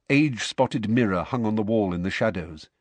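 usual speaking voice

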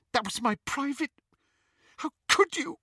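high, rising outrage; outraged sister. A narrator's reading. Petunia accusing Lily of reading her letter, high and outraged.

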